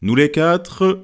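slow, drawn-out Nous les quatre, stress on quatre; slow toast opening. A narrator's reading. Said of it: "proposa le deuxième toast".

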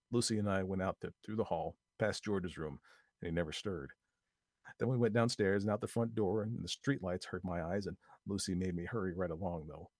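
usual speaking voice

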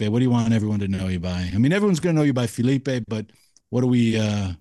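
ordinary talk, nothing marked

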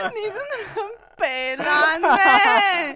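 slow, high, whiny drawl; whiny complaint. Real, not acted. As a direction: whine as if about to cry. Teasing complaint.

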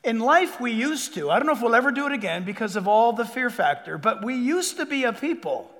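ordinary talk

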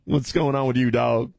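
put-on casual street-slang accent voice.

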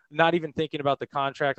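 normal talking voice

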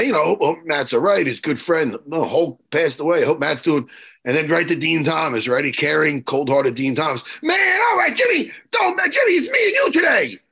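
usual speaking voice

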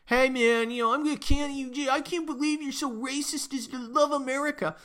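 hammed-up scolding accuser voice; mocking activist. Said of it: "someone who goes".

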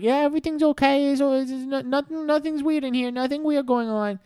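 put-on very high, loud fibbing kid; squeaky cover-up, slowing at the end; nervous kid lying. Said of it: "Timmy's like".

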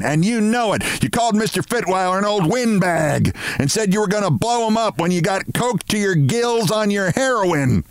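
high-pitched angry bawl, controlled volume; bawled accusation. A narrator's reading. Missus Barrows accusing Mister Martin, bawling. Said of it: "she bawled at Mister Martin".

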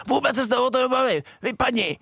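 high-pitched, dismissive mock ref; arrogant referee. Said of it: "ty arrogantní rozhodčí jsem neměl rád".

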